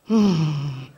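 long, low disapproving hum; low judging hmm. Retold or imitated. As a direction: hum it low like a doubtful auntie. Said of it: "Then she said".

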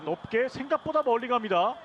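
builds from calm to a high shout; home run call. Real, not acted. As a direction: rise with the ball until it clears the wall.

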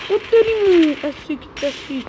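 loud, high-pitched yelp of surprise; startled exclamation. Real, not acted. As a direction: cry out, caught off guard. Startled exclamation during game fight. (चौंककर ऊँची आवाज़ में)

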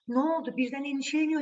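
ordinary delivery, nothing marked

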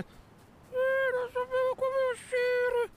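very high, slow falsetto; helpless little falsetto character; squeaky cartoon voice. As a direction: whine it like a tiny rescued creature. Plays squeaky rescued character.

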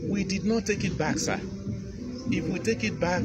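ordinary talk

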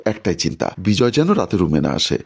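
usual speaking voice

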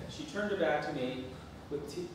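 normal talking voice

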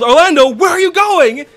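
high, loud shout after someone; urgent retold yell; yelled call. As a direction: yell it as if they are running off. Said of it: "I yelled".

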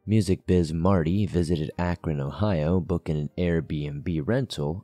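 low, even scripted voice-over; documentary narration. A narrator's reading.

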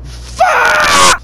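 very loud, high drawn-out scream; long high-pitched yell; screamed curse. Real, not acted. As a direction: let the curse rip at full volume. Sudden outburst in game.

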